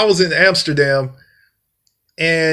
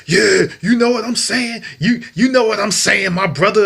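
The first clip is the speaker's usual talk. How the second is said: slightly higher, hammed-up street talk; lightly voiced try-hard coworker; mocking coworker slang; 5 semitones higher than usual. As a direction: parody a coworker faking slang. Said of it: "they'd be like".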